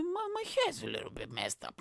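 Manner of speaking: high, prissy mocking voice; lightly hammed-up vain guy. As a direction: mimic a vain man fussing over his hair. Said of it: "He's gonna be like".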